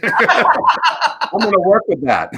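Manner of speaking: slow, laughing it out. Real, not acted. Joking to the hosts about being called cute, laughing. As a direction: chuckle through the joke, drawn out.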